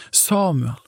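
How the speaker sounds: softly called name, pitched high and falling; calling out. A narrator's reading. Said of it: "Herren ropte ennå en gang".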